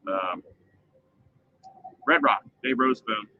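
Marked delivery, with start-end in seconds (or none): none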